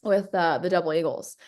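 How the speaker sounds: ordinary talk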